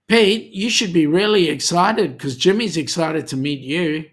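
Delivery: ordinary delivery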